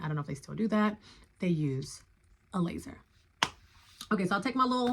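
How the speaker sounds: ordinary delivery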